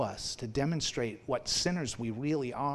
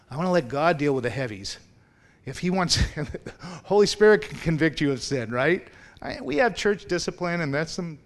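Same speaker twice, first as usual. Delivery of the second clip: louder, pushing hard on the heavies; fired-up pulpit emphasis; rising preacher's shout; 4 semitones higher, 9 dB louder than usual. Real, not acted. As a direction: preach it out at full force. Preacher rising to full force.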